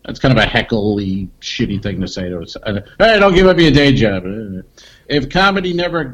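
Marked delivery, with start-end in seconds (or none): 3.0-4.2 loud heckle from the crowd; heckler yell. Shown as a demonstration. Comedian yells the day-job heckle at a flat joke, loud. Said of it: "Say it".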